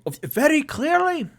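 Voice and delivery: very high, loud delighted halfling host; cheery halfling. Acted. Mister Peabody greeting returning guests at his door, very high and delighted. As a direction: squeal a bright welcome up high.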